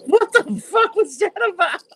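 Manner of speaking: high, cracking up incredulously; laughing disbelief. Real, not acted. Co-host reacting to a viral wrestling clip, laughing. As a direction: ask it through real laughter.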